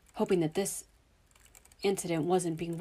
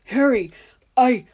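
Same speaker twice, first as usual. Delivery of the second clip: loud, higher call; relieved shout; 6 semitones higher, 14 dB louder, slower than usual. Acted. Dudley calling to his cousin in relief, loud. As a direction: call out, cut off mid-word.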